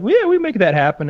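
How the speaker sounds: chirpy mock promise; high mock reply. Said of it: "they were like".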